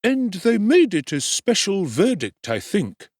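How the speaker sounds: high, slow, fawning undertaker voice; high ingratiating tradesman; oily undertaker. Acted.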